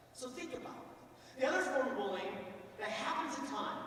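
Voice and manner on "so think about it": hushed urging. Real, not acted. Urging students to reflect.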